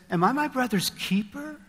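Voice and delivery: defiant Cain. Acted. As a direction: fire back as an offended Cain. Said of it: "He says".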